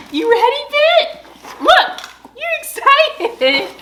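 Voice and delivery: very high excited pet voice. Real, not acted. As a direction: squeal it like talking to a puppy. Talking to excited dogs.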